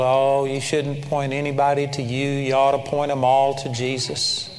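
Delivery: low, soft droning imitation; subdued, monotone mock piety; mock pious objection. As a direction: mimic a disapproving churchgoer on one low note. Mimicking most people's pious objection.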